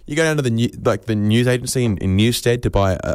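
ordinary delivery

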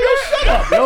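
very high-pitched mock yell; exasperated retort. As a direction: shoot up into a squeal of laughing protest. Retold yell at grumbling cinema audience.

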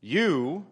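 "You" set alone before a long pause; a drawn-out, isolated "You"; emphatic YOU. Shown as a demonstration. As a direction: let "You" stand by itself, weighty. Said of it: "also emphatic, similarly emphatic, saying you twice".

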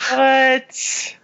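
outraged surprise. Real, not acted. Outraged at opponent's bonus.

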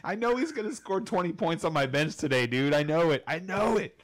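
loud, high laughing rant; exasperated laughing. Real, not acted. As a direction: howl with frustrated laughter. Exasperated laughing outburst about bench.

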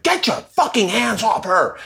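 high, drawn-out outraged order; retold fight, high and slow; mock angry yell. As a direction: imitate a furious woman stepping in. Retold bar fight, angry girlfriend.

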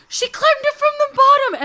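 astonished old-lady impression, very high and loud; imitated little lady, shrill with amazement; high-pitched little voice. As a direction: squeal the news to her companions. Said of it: "she has this high-pitched little voice".

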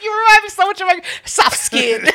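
high voice breaking into giggles; laughing. Real, not acted. Joking about the man's flirting, laughing. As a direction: get the mock pickup line out through laughter. Joking line while cracking up.

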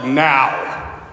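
a stern father putting his foot down; Father's firm command. Retold or imitated. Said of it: "the Father steps in and is like".

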